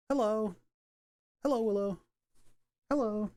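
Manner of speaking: high cooing hello to the dog. Real, not acted. Greeting the corgi in baby talk. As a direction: coo hello up high at the puppy. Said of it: "Sorry for the baby talk".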